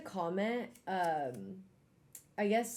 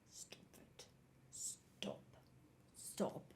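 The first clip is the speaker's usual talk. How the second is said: very soft, breath-only whisper; hushed acted-out plea to stop; whispered warning; 17 dB quieter, much slower than usual. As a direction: whisper it through mock annoyance. Acting out how she'd react.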